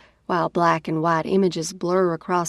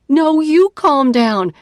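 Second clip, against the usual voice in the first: higher, louder, slower snapped retort; angry retort; 8 semitones higher, 9 dB louder, slower than usual. Acted. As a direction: throw the words back at him. Retort to calm down.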